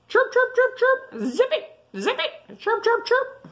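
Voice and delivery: very high chirping chipmunk. A narrator's reading. Chipmunk tells the sleeper off, high chirpy voice. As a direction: squeak it out in staccato chirps. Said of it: "says the chipmunk".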